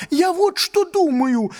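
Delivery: high excited waiter babble; rapid chattering. Acted. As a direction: gabble it out, words tumbling. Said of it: "затараторил он".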